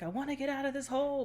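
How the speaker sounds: high, fast frustrated voice; frustrated whine. Retold or imitated. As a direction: whine it like someone stuck and fed up. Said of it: "He's like".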